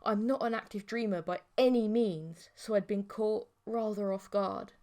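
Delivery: plain reading voice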